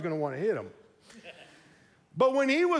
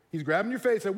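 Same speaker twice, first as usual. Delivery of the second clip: ordinary talk, nothing marked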